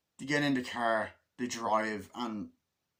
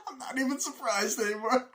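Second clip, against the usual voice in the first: laughing quote; 12 semitones higher, 4 dB louder than usual. Retold or imitated. Characters laughing at burning car.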